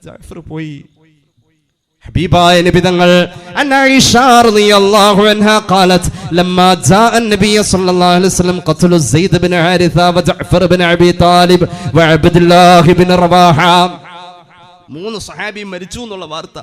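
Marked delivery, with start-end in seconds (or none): 2.1-13.6 loud sermon recitation of hadith; preaching. Acted. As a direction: declaim the Arabic hadith with force.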